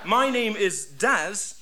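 very slow, sugary introduction; slow and nice. Acted. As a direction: draw it out sweetly. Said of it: "terribly nice".

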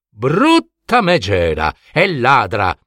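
plain reading voice

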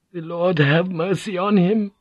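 high-pitched, hushed horror, voiced; narrator's high, awed whisper for a shocked man; horrified whisper. A narrator's reading. Crewman praying into the horrified silence, high horrified whisper. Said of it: "Van Neck whispered over the horrified silence".

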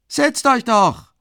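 very high, loud, slow jeering call; mocking call. A narrator's reading. Man in the crowd jeering at the carter, loud and slow. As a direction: drag it out as a jeer. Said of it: "ruft man lachend in der Menge".

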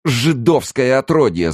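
high, loud, drawn-out furious scream; yelled slur. A narrator's reading. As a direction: scream the insult slowly and hatefully. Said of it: "заорала".

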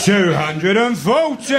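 slow, loud, drawn-out darts announcer bellow; darts caller. Retold or imitated. Darts match announcer calling the big score, loud and slow.

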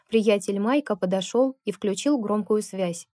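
usual speaking voice

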